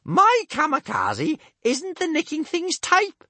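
very high, slow crying out; a loud Viking mother crying out a denial; crying out Bertha. A narrator's reading. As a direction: cry out in defence of her daughter. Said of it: "cried big-boobied Bertha".

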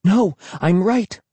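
put-on high, slow, stubborn girl's insistence; insistent. A narrator's reading. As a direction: insist on it, stretching the words. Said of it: "she insisted".

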